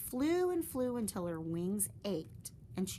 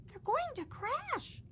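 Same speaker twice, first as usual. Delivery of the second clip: very high, slow alarmed gasp; horrified; high frightened breath; slower than usual. Acted. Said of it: "gasped Stellaluna".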